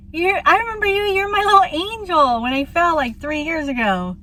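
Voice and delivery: a hint of a grateful old man; old man's thanks. The old man thanking his little angel, lightly voiced. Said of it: "He's just like".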